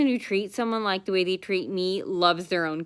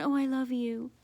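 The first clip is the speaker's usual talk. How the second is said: mock sweet; 4 semitones higher, 6 dB quieter than usual. Mocking coworker's fake sweetness.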